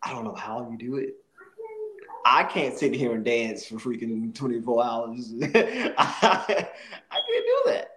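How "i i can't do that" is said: high-pitched laughing reply. Real, not acted. Admitting he can't dance all day, laughing. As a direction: laugh your way through it. Laughs at his own limits.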